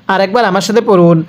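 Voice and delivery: normal talking voice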